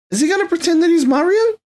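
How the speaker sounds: high, rising disbelief on Mario; incredulous. Real, not acted. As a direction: ask it in mock disbelief, voice climbing. Mocking a casting choice.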